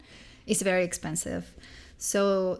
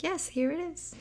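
higher, soft polite voice; lightly voiced mother; 9 semitones higher, 5 dB quieter than usual. Sammy's mother handing him the phone, soft high. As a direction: answer like a gentle mom.

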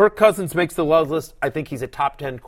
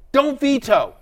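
pitched up and pushed hard; emphatic plea; 9 semitones higher, slower than usual. Real, not acted. Host begging fantasy players to stop vetoing, emphatic plea. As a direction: plead it like a rallying cry. Urging fans never to veto.

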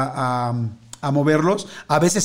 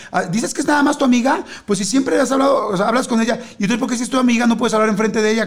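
high, fast, accusing partner; acting out a jealous lover's outburst; jealous accusation; 6 semitones higher, faster than usual. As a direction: needle them with jealous suspicion. Acted jealous partner in fable.

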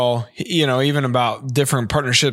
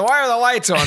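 dad yelling voice; 9 semitones higher than usual. Retold or imitated. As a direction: scold like an annoyed dad. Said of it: "The dad yelling voice".

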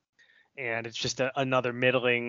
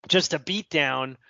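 ordinary talk

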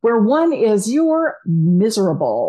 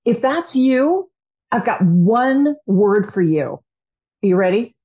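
ordinary delivery

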